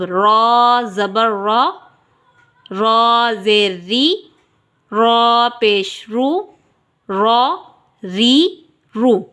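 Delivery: रॉ full and heavy, री thin, रू rounded, said slowly; teacher's spelling drill; slow drilled reading. Shown as a demonstration. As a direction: spell the raa sounds slowly for the learner. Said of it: "रॉ को पुर ... बरीक पढ़ना है".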